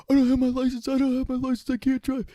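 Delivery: high, loud panicked squeal. Retold or imitated. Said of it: "And he's like".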